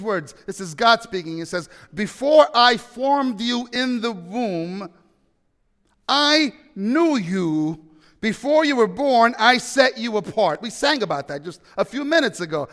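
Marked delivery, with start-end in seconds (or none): 1.9-10.6 solemn scripture reading. Acted. Reading God's words from Jeremiah.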